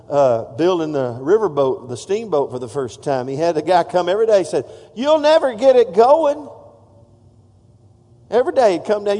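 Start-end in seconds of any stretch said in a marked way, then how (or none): 4.9-6.5 mocking taunt. Scoffer taunting the steamboat builder.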